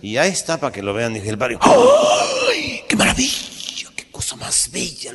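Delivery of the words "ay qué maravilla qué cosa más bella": gasping high then whispering in awe; breathless amazed whisper; awed gasp. Acted. Priest marveling at the nun, awed whisper. Priest gasps at nun's body.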